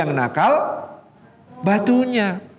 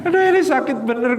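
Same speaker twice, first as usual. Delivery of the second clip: higher, pained complaint; aching old mother; 6 semitones higher, faster than usual. Retold or imitated. Old mother begging her son to see her pain, pained and higher. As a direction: plead with the son, pitch rising in pain.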